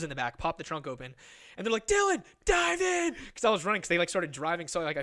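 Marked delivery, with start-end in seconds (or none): 1.9-3.1 high, loud drawn-out mock yell; urgent calling out. Friends calling from the getaway car, urgent mock yell. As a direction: holler it to a runner from afar.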